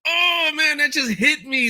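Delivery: high, stunned and moved; overwhelmed awe. Retold or imitated. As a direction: let the line knock the wind out of you. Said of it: "I was like".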